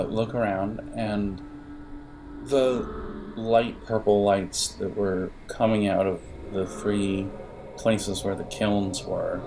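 usual speaking voice

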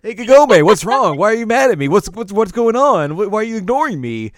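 loud, high, pleading questions; whiny Inuyasha. Retold or imitated. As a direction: pester her loudly and high-pitched. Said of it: "That would've made things so much worse".

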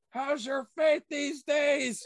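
high, drawn-out dramatic demand; hammed-up taunt. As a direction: build it into a loud, slow cry. Quoted movie line repeated, escalated.

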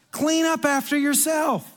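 high, slow stern order; mock-stern manager laying down the law; scolding manager. Manager orders customer to clean.

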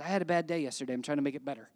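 low, quieting earnest reply; dead serious. Retold or imitated. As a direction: imitate a friend answering in all seriousness. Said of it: "in all seriousness".

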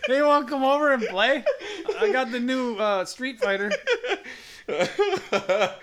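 a hint of a young schoolkid; junior high kid. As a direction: play an eager schoolboy asking a friend to come play. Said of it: "you're in junior high again".

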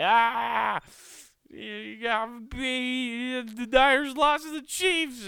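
high, loud, sneering fan voice; mocking gloating fan. Mimicking Chiefs-fan family taunting him, high and mocking. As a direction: taunt like a smug rival fan. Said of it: "talking shit to me, just like".

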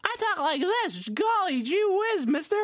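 put-on high, loud golly-gee kid voice; squeaky wholesome all-American boy; wholesome 1950s kid. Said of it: "I've never talked like that in my life".

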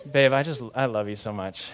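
soft, low sweet nothing; sweet tenderness. Retold or imitated. Husband to wife in bed at night, sweet and tender. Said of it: "having a super sweet moment".

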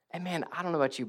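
ordinary delivery

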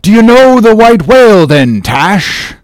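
high, forceful call; excited shout. Acted. Ahab shouts to crew.

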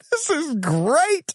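very high, slow, gleeful cry; excited squeal. Retold or imitated. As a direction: gush it out like a thrilled kid. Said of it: "I'm like".

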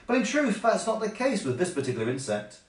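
ordinary delivery, nothing marked

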